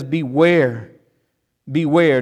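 BEWARE repeated firmly with a falling tune; emphatic warning. Acted. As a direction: land hard on each BEWARE. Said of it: "he's emphatic".

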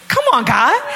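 mock plea. Acts out her plea to God.

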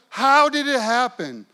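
can't believe the refund; amazed question. Retold or imitated. Amazed wife asks.

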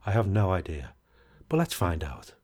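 ordinary talk, nothing marked

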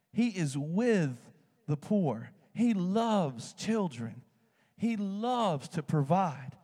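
slow, deliberate preaching; preaching emphasis. Real, not acted. Preacher telling the church about God, slow and deliberate. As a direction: lay each phrase down slowly.

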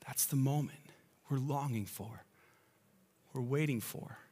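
ordinary talk, nothing marked

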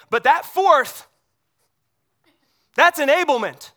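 loud punch on "fourth" and "enablement"; emphatic preaching. Real, not acted. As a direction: hit it hard from the pulpit. Preacher driving home point.

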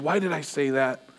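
soft, muttered inner question; brooding to yourself over a slip; self-doubting inner voice. Pretend. Lying awake muttering at your own mistake. Replaying conversations in bed at night.

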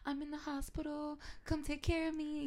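put-on needy high school girl. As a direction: imitate a girl pleading for attention. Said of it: "like the girls in high school".

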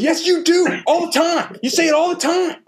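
very high, loud falsetto protest; mock group outcry. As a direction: imitate a whole crowd squealing back in insistence. Mimics everybody contradicting him.

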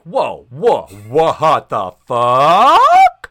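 loud, slow drawn-out shock. Retold or imitated. Mike hearing her age, loud slow shock. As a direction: recoil in disbelief. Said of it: "Mike is like".

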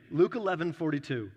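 ordinary talk

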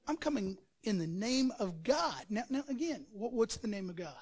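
normal talking voice

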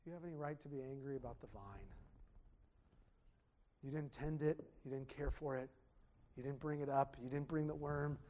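hushed, gentle God questioning Jonah; God asking gently. Acted. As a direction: let God ask quietly, almost under the breath. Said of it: "And God says".

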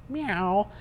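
high, rising kitty meow; high-pitched imitated meow; cat meow. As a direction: mimic a cat from a picture book. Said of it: "every farm animal".

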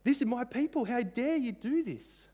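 God rebuking the nations, high and indignant. Acted. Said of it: "And he's saying".

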